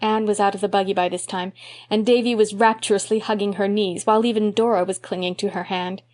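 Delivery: normal talking voice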